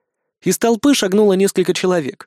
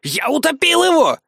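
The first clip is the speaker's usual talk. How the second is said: lying boaster defending himself, pitched up; indignant braggart; 10 semitones higher than usual. A narrator's reading. Iggy denies bragging to the crowd, high insistent voice. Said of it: "гнул свою линию Игги".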